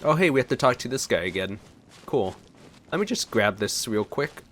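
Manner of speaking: ordinary delivery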